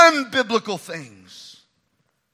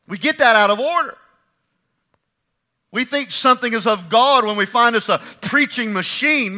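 normal talking voice

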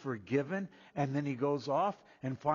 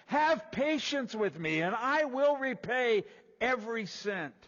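higher, louder, slow plea for patience; begging slave; 11 semitones higher, 7 dB louder than usual. Acted. Slave on the ground begging his lord, higher, louder, slow. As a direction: plead on your knees for time. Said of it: "the slave fell to the ground... saying".

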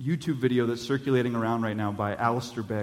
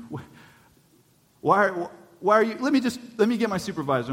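a flustered angel, stammering and higher; 8 semitones higher than usual. Acted. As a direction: stumble over the words like a confused gatekeeper angel. Flustered angel at heaven's gate.

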